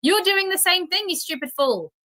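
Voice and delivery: high-pitched scolding; exasperated retort. Real, not acted. Insults opponent in heated retort.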